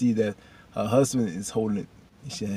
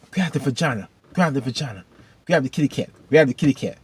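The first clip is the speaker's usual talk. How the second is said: higher-pitched, chanting catcall; repeated mocking shout-out, pitched up; imitated heckler; 4 semitones higher, 4 dB louder than usual. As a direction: call it out like a prankster heckling. Imitates random guys heckling a reporter.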